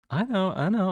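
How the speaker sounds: high, loud, gleeful; bright teasing I know, said high and loud; playful boast. Real, not acted. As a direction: crow it back at chat.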